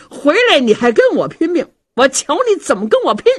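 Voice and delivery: high, loud husband's outburst; angry challenge. Acted. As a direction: dare her to fight you. Husband answers wife's attack angrily. (高声怒斥)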